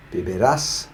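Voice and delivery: vivirás with emphasis on rás; vivirás, rising onto a strong rás; final-syllable stress. Shown as a demonstration. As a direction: punch the last syllable of vivirás. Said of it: "stressing that very last syllable".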